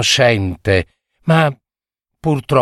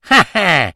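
short high cackling laugh; witch cackle; 6 semitones higher than usual. Acted. Witch cackling after her bargain with the fisherman.

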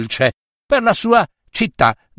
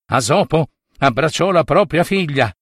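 plain reading voice

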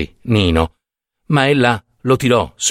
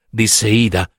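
usual speaking voice

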